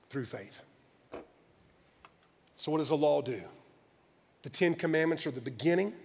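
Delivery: low, soft, measured preaching; hushed scripture teaching. Real, not acted. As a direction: bring it down to a quiet, reverent close. Quiet reading and teaching of scripture.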